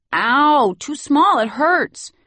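put-on high, loud child crying out in pain; a little boy yelping that it pinches; whiny child complaint. Little boy whining to nanny about tight shirt.

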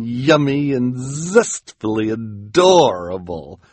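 theatrical flattery with swooping pitch; gushing villainess. Acted. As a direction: savour each compliment, varying every one. Esme gushes a list of compliments.

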